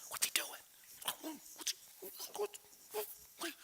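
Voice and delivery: hushed, whispered confusion; acted baffled whispers, broken by pauses; bewildered whispering. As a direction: whisper it furtively to the one beside you. Disciples murmuring among themselves, baffled.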